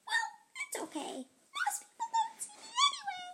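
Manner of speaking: very high, fast, whimpering ant; tiny ant voice. Acted. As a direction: brush it off like a tiny sad ant. Ant answers the girl.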